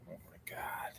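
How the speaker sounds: frustrated whisper under the breath; whispered oh my God. Real, not acted. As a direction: hiss it to yourself in disbelief. Frustrated with the iPad, muttered aside.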